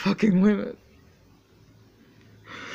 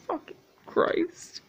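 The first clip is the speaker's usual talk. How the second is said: slow, whispered despairing oath; a man worn out by his mother's voice, whispering; whispered curse; 8 semitones higher, 8 dB quieter, slower than usual. Acted. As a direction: breathe it out in despair.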